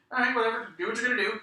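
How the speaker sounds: high, loud mock-defiant quote; acted brash dismissal. Acting out a defiant wrestler.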